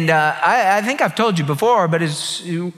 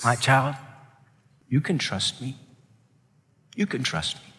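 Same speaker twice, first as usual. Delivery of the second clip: soft, tender voice of God, much softer; sweetly; 5 semitones lower, 8 dB quieter than usual. A narrator's reading. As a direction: speak it gently, like comforting a child. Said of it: "God spoke to her so sweetly".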